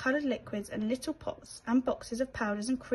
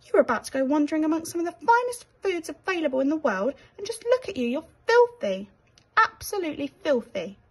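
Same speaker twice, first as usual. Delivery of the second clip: a loud, indignant fussy rat, higher pitch; scolding rat; 6 semitones higher, 6 dB louder than usual. Acted. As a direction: tell him off with outrage. Rat scolding dirty mouse.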